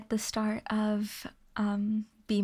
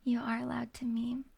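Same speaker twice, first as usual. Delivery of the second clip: breathy whispered reassurance. Shown as a demonstration. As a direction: hush it like an ASMR aside. Repeats answer to chat whispered.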